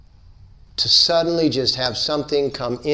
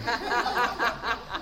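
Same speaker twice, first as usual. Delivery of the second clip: bright high laugh; a real amused laugh; 10 semitones higher, 8 dB quieter than usual. Real, not acted. Speaker laughing at her own joke, high laugh.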